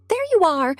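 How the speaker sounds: high, loud call across the room. A narrator's reading. Hostess Jenny greets him across the room, calling out. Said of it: "Jenny called out".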